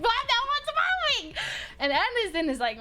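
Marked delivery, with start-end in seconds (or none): 0.0-1.3 high, stretched-out mock yell; exasperated outburst. Teller replaying her reply to "I'm ready", exasperated. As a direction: squeal it out in disbelief, drawing the words out.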